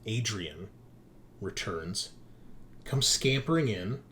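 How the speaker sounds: ordinary delivery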